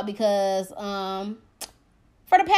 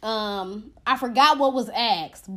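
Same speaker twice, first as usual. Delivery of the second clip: ordinary talk, nothing marked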